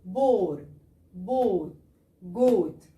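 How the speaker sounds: each word with a rounded o. Shown as a demonstration. Teacher modelling rounded o words for students. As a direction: round the lips on each vowel. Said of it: "زي الواو المضمومة".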